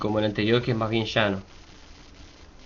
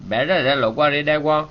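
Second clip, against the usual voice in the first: higher, ringing recital of a Yoruba prayer; oriki recitation; 4 semitones higher, 6 dB louder, faster than usual. Shown as a demonstration. Teacher reciting an oriki for learners, ceremonial recitation. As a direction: proclaim the praise verses phrase by phrase.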